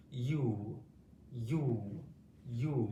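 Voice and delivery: "U" said alone three times with pauses; drilling the U sound. Shown as a demonstration. Teacher models the U sound for pupils, drilled. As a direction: repeat "U" slowly and clearly. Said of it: "E W can make the U sound".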